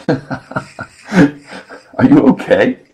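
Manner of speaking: laughing through the question; slow, giggling question. Retold or imitated. Wife asks, laughing.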